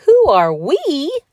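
loud, high, drawn-out disbelief; affronted cry. A narrator's reading. Donald is not recognised by Patrick, affronted cry. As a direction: cry it slowly, scandalized. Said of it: "cried Donald Duck".

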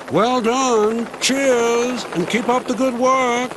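very high, sing-out helicopter voice; jaunty helicopter farewell. A narrator's reading. Helicopter signs off to the little diesel, high and jaunty. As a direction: call it out high, holding each phrase.

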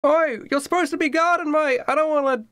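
high, far-off Cockney pirate captain, friendly but bossy; the captain calls out to his new recruit, high and mocking. GM as pirate captain greeting a fisherman, high cheeky voice.

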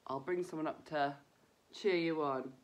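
ordinary talk

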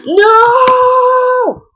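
a gasp then a high, sung-out no; shocked gasp. Real, not acted. Gamer reacting to a bad hit, gasping high no.

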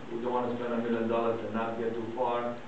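usual speaking voice

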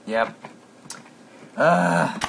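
usual speaking voice

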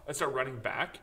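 ordinary talk

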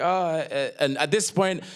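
ordinary talk, nothing marked

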